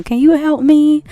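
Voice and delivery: high, loud panicked plea; hammed-up begging for help; acted distress. Acting out her crying in the car, loud high panicked plea.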